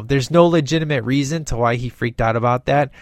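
ordinary talk

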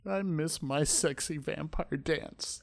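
slow, quiet forlorn vampire; soft and wistful, drawn out; head vampire. Retold or imitated. Head vampire left out of the party, slow quiet lament. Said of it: "the head vampire is there with his leather".

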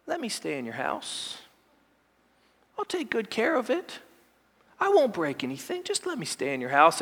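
shy plea, barely voiced, to keep a house; timid young man. Preacher imitating a timid young man, soft and pleading. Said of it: "this timid young man".

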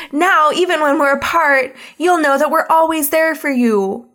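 high, hammed-up earnest friendship speech; Taya. Taya painting friends' hands, mock-earnest speech. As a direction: sweep through it like an anime pep talk.

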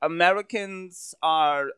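put-on slow, halting German-accented English. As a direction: read it out word by word like a stiff German tourist. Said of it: "I don't know if that's a good German accent".

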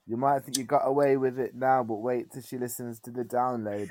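ordinary talk

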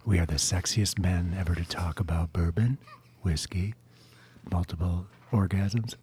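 low hushed golf announcer voice; golf commentator. Pretend. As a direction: narrate it like a quiet golf broadcast. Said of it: "You should just talk like that the whole time".

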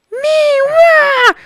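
crying wail. Acted. Animals crying out.

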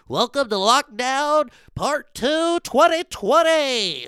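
slow, loud show-host announcement; announcer intro. Real, not acted.

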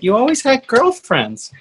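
high-pitched mock mom protest; imitated protesting mom. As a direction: do mom's voice, pitched well up. Imitating a disbelieving mom in retelling.

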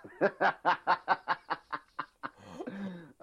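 helpless drawn-out laughing fit; long laugh. Real, not acted. Co-host cracking up at the Batman story, long real laughter. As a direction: crack up at the joke.

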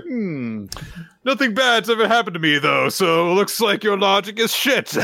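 high, smug, offhand taunt; smug Fang. Retold or imitated. Fang mid-punch mocking Shen's logic, high and smug. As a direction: sneer it while throwing a punch. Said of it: "while just going like".

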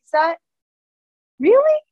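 high, loud rising really; stunned question. Retold or imitated. Shocked dad realizes it's his daughter on the book. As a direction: shoot up in disbelief. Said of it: "he was like".

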